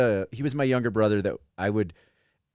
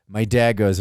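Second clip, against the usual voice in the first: normal talking voice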